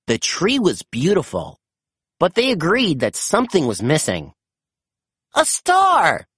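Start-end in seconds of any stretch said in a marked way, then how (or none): 5.4-6.3 high, bright cry of discovery; excited exclamation. A narrator's reading. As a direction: burst out with the idea. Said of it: "Sky exclaimed".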